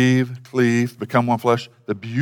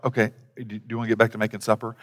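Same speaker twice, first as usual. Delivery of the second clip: quieter and quick, brushing it off; bored wife; 4 semitones lower, 6 dB quieter, faster than usual. Retold or imitated. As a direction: hurry him along, unimpressed. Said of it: "she's just like".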